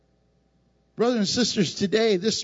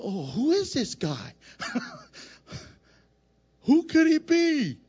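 mock bafflement; 5 semitones higher than usual. Crowd's mock confusion.

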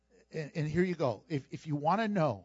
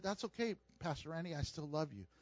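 forgiving reply; 2 semitones lower, 10 dB quieter, faster than usual. Retold or imitated. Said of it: "he turned around and said".

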